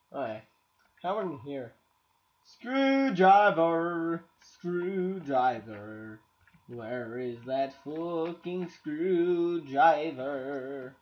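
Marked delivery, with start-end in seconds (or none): 2.4-11.0 slow drawn-out calling. Pretend. Drawn-out mock call.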